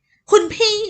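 very high, loud indignant cry; shrill outcry. Acted. Younger sister cries out at elder sister, outraged. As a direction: shriek at her sister in outrage.

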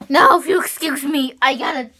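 ordinary delivery

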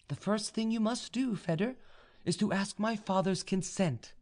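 high, composed feminine voice; young noblewoman. A narrator's reading. Vaninka tells the suitor what to do, high and composed. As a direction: instruct him in a high voice. Heroine instructs her suitor.

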